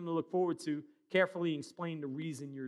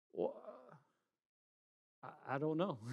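sheepish mumble; 7 dB quieter, faster than usual. Retold or imitated. Sheepish answer to wife.

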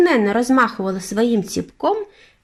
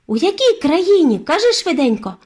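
put-on impatient boy, much higher and louder; impatient exclaim; 9 semitones higher, 4 dB louder than usual. A narrator's reading. Boy pressing his grandmother about the castle, impatient. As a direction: blurt it out, can't wait. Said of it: "нетерпляче вигукнув я".

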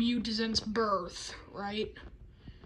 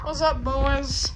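usual speaking voice

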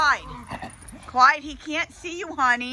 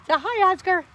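coaxing; 7 semitones higher than usual. Real, not acted. Coaxing a pet again.

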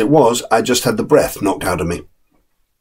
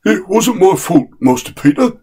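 higher, deferential servant's voice; respectful chauffeur; 10 semitones higher than usual. Acted. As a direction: plead innocence politely to the young master. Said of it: "said the chauffeur respectfully".

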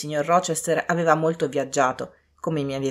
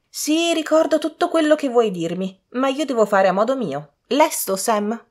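put-on high, impatient young lady; imperious; 8 semitones higher, 4 dB louder than usual. A narrator's reading. Daughter overruling her mother, imperious high voice. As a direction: brush off advice in a high voice.

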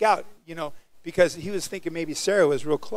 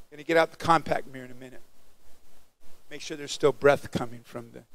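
normal talking voice

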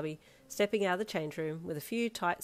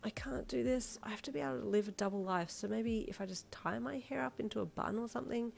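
soft, higher, worried self-talk; fretting to oneself; 5 semitones higher, 5 dB quieter than usual. Acted. Alone at the mirror, quietly fretting. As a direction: think aloud quietly, doubting yourself.

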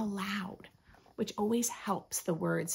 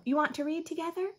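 put-on higher, quick eager child; little girl; 7 semitones higher, faster than usual. Girl inviting the cat to read, fast and higher. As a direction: rush the offer out eagerly. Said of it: "said the girl".

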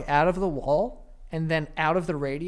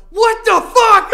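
much higher, much louder, slow stunned cry; shocked outburst; 14 dB louder, slower than usual. Retold or imitated. Retelling the TikTok guy watching TV, a shocked loud cry. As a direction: blurt it like seeing the towers hit.

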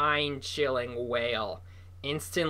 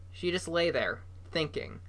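usual speaking voice

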